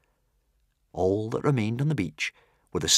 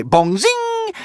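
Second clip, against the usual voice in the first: bouncing sound effect; 4 dB louder than usual. Acted. Dart rebounds.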